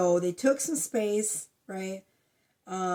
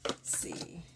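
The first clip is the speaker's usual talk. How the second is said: breathy murmur, much softer, no voice; hushed aside; whispered to herself; 3 semitones lower, 10 dB quieter than usual. Real, not acted. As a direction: whisper under her breath. Muttered to self.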